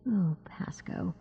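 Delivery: slow, hushed whisper. A narrator's reading. Sandry whispering for her captured student, slow whisper. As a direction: breathe it out slowly, barely audible. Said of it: "whispered Sandry".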